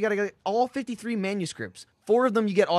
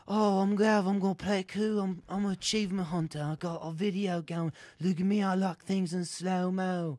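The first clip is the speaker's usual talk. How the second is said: Gav. Retold or imitated. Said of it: "there's Gav when there's just like".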